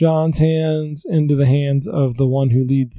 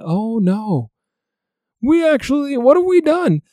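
mild anguished cry; 8 semitones higher than usual. Retold or imitated. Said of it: "they are screaming, that they are crying".

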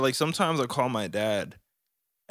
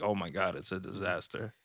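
much softer, lower dejected sigh; gloomy; 4 semitones lower, 9 dB quieter, faster than usual. Retold or imitated. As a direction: moan it softly, defeated. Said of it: "it's like".

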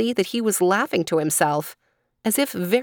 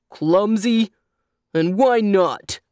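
loud, indignant donkey demand; angry donkey; 4 dB louder, slower than usual. A narrator's reading. Donkey answers the child who called him clumsy, angrily. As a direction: demand it angrily, drawing out the words. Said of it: "demanded the donkey with angry voice".

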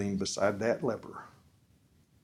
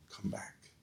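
hushed question; 3 semitones lower, 14 dB quieter than usual. Acted. Preacher drops to a hush.

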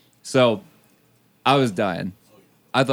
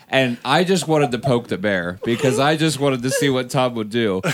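ordinary talk

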